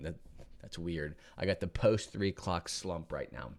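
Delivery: normal talking voice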